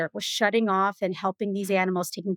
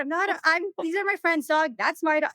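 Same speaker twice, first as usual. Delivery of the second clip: high, stumbling retold protest; flustered protest; 7 semitones higher than usual. Woman explains these are friends' dogs, flustered protest. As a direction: act out setting someone straight, pitched up.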